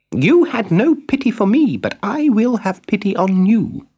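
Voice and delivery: a small creature giving a stern lesson; scolding cricket. Acted. Talking cricket lectures Pinocchio.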